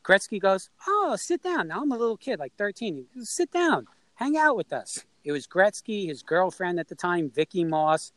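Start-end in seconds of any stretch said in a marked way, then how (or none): 0.9-1.7 high-pitched acted welcome; friendly invitation. Star player inviting a little kid to join, in mock welcome. 3.3-5.1 high-pitched imitated welcome; friendly invitation. Star player asking a kid to hang out, acted friendly and high.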